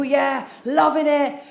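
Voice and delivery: ordinary delivery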